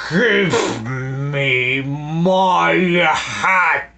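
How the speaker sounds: slow drawn-out villain hiss. A narrator's reading. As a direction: stretch it into a menacing hiss. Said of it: "Snatcher hissed".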